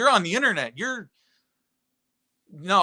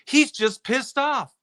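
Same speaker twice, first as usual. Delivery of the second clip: ordinary talk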